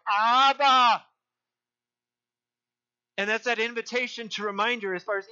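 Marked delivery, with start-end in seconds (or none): 0.1-1.0 loud, high cry of suffering; anguished cry out. Acted. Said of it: "he cried out".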